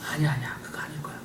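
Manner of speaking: fast, hushed whisper of nagging doubt; quick voice of doubt, hushed. Acted. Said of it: "지성은".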